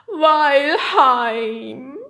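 high, loud, tearful howl; homesick yeti wail. Acted. Glassy-eyed yeti longs for home, wailing. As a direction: stretch both words into a crying howl.

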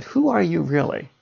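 "Who are you really?" zacht en nadrukkelijk; stress on who are you really. Shown as a demonstration. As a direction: elk woord van "Who are you really?" benadrukken. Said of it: "And I stress that".

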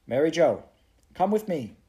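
high, loud summons; urgent command. Acted. Prue calling Mary Joe into the cottage, urgent command. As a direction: call her along firmly. Prue orders friend into fire.

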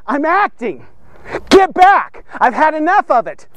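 God's furious command; stern God. Acted. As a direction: order them back sternly. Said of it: "God has decided".

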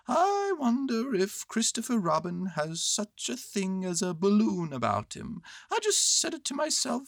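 musing bear in a high voice; Winnie the Pooh. Acted. Bear explaining his balloon wish, musing high voice.

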